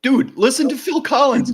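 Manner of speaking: high, loud, hammed-up fan plug; mock hype shout. Hammed-up shout to a co-host about [name].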